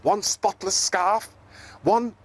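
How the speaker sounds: proud little zebra listing his washing; cheerful zebra counting, cut off by wind; Zippity voice. Acted.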